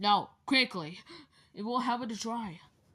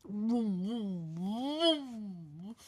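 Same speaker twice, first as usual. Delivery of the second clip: car engine noise; 4 dB quieter, much slower than usual. Pretend. Kid imitates car engine.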